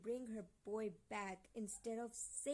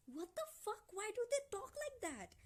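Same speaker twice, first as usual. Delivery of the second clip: high-pitched exasperated protest; real, incredulous exasperation; outraged bafflement; 11 semitones higher than usual. Real, not acted. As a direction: demand an explanation, voice shooting up. Outraged reaction to the book.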